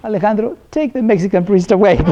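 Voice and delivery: high, put-upon pleading voice; mock exasperated plea. Community calling Alejandro to complain, high exasperated plea. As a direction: imitate a caller who has had enough. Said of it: "I got the call".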